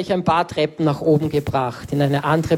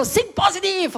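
much higher, shouted mock cheer; teasing friend; 13 semitones higher than usual. Friend poking his grumpy mate, high mock shout. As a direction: ham up a pushy buddy yelling at you. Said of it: "er war so".

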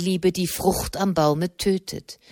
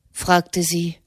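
usual speaking voice

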